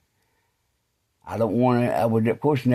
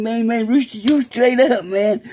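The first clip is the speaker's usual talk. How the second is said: high-pitched, tickled excitement; laughing little kid; 12 semitones higher, 4 dB louder than usual. Retold or imitated. Friend delighted by Rooster's call, high and giddy like a kid. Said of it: "he like little kid, he like laughing".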